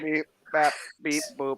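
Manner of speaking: beeping robot voice; robot noises, mechanical. Pretend. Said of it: "[name]'s gone robot voice again".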